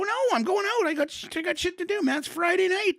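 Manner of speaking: put-on bratty teen protest.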